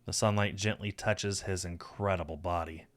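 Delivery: plain reading voice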